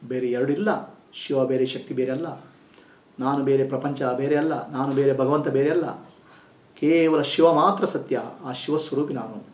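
soft, slow meditation voice with long pauses; guided meditation. Acted. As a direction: guide them inward, gently and unhurried.

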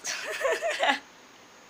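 very high, squealing laugh. Real, not acted. Laughs again.

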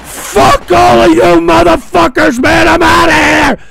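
loud, high, slow blowup; furious shout. Pretend. Host quitting the show on air, furious shout. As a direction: explode at the whole audience. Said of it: "I know that I'm angry".

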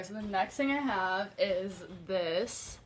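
ordinary talk, nothing marked

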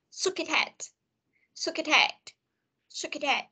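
"Shook it head" repeated as one joined unit; linked pronunciation. Shown as a demonstration. Teacher drills the phrase for students, "Shook it head" linked. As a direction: run "Shook" into "it", then "head". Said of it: "Mình sẽ đọc là shook it head".

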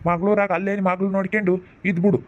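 ordinary delivery

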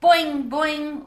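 high, loud boing; boing like a happy frog. A narrator's reading. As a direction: frog answering his friend. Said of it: "was the response".

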